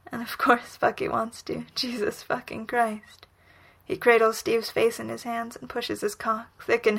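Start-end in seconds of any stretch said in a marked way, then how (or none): none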